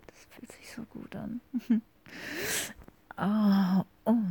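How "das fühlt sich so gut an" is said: barely audible whisper. Acted. To her partner, enjoying it: faint whisper. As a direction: breathe it almost silently.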